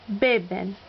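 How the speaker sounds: beben with the first BE stressed; stress on second-to-last syllable. Shown as a demonstration. Teacher demonstrating a Spanish rule, stress on second-to-last syllable. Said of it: "accent on the second to last syllable".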